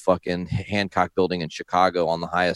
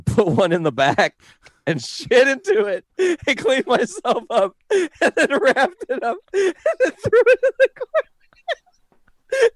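rising far higher into squeaky, crying laughter, slowing at the end; helpless laughter. Real, not acted. Quoting Nash's gross confession, dissolving into laughter. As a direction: lose it completely mid-sentence. Retelling Nash, breaking up.

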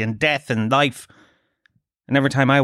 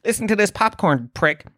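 much higher, indignant outburst; outraged rant; 6 semitones higher than usual. Retold or imitated. Telling his girlfriend at home, higher outraged rant. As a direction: burst out like the film no longer matters. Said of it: "I was like".

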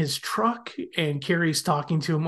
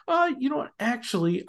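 mock annoyed; 9 semitones higher than usual. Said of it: "so annoyed".

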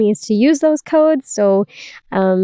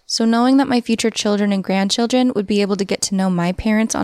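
ad read; 3 dB quieter than usual. Acted. Host reads a sponsor ad.

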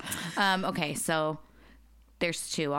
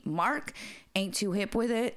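ordinary talk, nothing marked

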